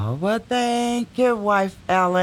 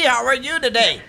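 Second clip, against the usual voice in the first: ordinary talk, nothing marked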